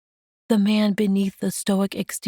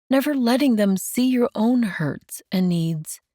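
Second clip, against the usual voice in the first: lightly raised, tender woman's voice; gentle Mandy; 6 semitones higher than usual. Acted. Mandy comforting Enoch, lightly raised and tender.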